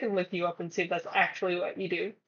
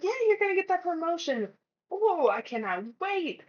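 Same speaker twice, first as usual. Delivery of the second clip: higher, chirpy cheering voice that settles; mock excited friend; 9 semitones higher than usual. Close friend cheering on the promotion, mock excited. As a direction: play the gushing friend who can't wait.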